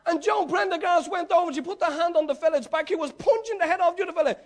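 ordinary talk

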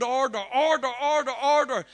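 loud, rising commands, each one harder; commanding repeated order. Retold or imitated. Preacher commanding healing over the man in the wheelchair. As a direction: build the command, order after order. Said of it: "I knew God was on that word".

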